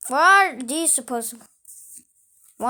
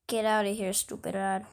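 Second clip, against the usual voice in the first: ordinary talk, nothing marked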